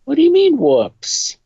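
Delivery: acted exasperated retort; indignant. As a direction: throw it back sharply, starting high and dropping. Acted quarrel over the cupcakes.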